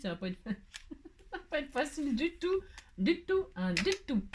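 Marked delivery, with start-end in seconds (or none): 0.9-4.2 "du tout" said higher, laughing through it. Real, not acted. Crafter admitting to viewers it's hard, laughing. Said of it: "je le dis tout de suite".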